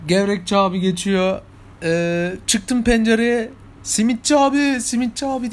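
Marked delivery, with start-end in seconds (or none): 3.8-5.5 mock shout from the window, pitched up; calling out to the street vendor; called out. Shouting from the window to the simit seller, calling out. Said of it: "diye bağırdım".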